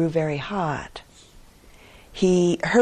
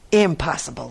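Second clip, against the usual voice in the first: ordinary delivery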